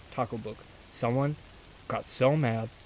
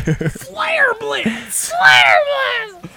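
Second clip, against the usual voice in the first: high, loud battle cry; shouting; 7 dB louder, much slower than usual. Pretend. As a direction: yell it like an attack name. Shouting Pokémon move.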